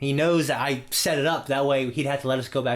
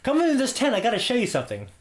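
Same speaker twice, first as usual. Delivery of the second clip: sly invite; 10 semitones higher, faster than usual. Retold or imitated. Luring girl into tent, high voice.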